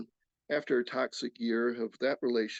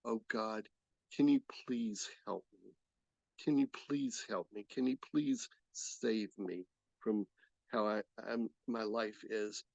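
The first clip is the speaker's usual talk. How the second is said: desperate plea; 3 semitones lower, 6 dB quieter than usual. Retold or imitated. Begging God on the phone for help, soft and pleading. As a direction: beg quietly for rescue.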